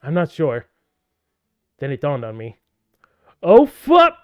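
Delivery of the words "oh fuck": loud high scream; panicked scream. Acted. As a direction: scream as you fall. Realizing the fall.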